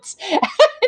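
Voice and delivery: a high burst of real laughter; short amused laugh. Real, not acted. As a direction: laugh at the absurdity. Laughing at the story.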